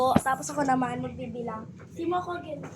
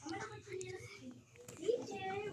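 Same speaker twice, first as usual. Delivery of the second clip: whispering while hiding; 17 dB quieter than usual. Real, not acted. Searching for the hidden player.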